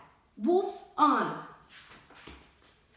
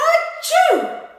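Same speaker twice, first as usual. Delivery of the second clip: loud, high exploding achoo; huge sneeze; 10 dB louder than usual. Acted. Wolf blasting a sneeze at the straw house. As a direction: let a giant sneeze burst out. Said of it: "I felt a sneeze coming on".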